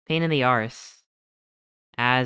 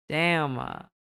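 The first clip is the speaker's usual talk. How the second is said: ordinary delivery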